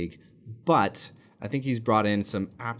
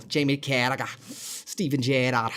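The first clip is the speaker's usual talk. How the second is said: names said in a put-on Scouse accent; 2 semitones higher than usual. Shown as a demonstration. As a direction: give the names a Liverpool Scouse lilt. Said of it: "go in and out of the Scouse".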